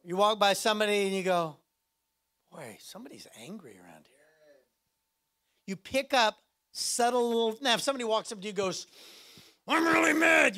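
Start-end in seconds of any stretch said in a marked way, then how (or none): 2.5-4.2 hushed whisper, barely voiced. Whispering to yourself as you pass an angry person. Sensing anger, hushed aside. 9.7-10.6 high, loud put-on yell; mock angry shout. Someone walks up and yells that they're mad. Someone announcing their anger.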